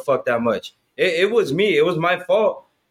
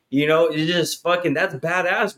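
ordinary delivery

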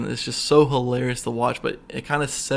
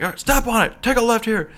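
high, pushed backseat commands; mock urgent orders; 10 semitones higher, faster than usual. Cop in back seat to driver, mock urgent. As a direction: imitate barking directions from the back.

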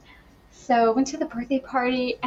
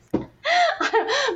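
laugh. Real, not acted. Laughing at herself.

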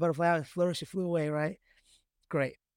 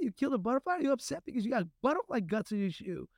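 incredulous parent; 8 semitones higher than usual. Retold or imitated. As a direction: question the kid in mock disbelief, voice pitched up. Parent's baffled questioning of crying child.

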